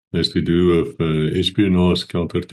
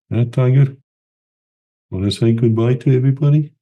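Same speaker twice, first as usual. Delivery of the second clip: higher, louder, coaxing voice to the cat; pet talk; 6 semitones higher, 5 dB louder, faster than usual. Real, not acted. Owner coaxing his cat Tiger to say goodbye, high cooing pet talk.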